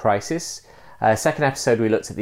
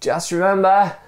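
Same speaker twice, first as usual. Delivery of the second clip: high-pitched call; high, insistent storyteller's call; acted shout; 10 semitones higher, 4 dB louder than usual. A narrator's reading. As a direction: shout it up from below. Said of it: "shouted the other man".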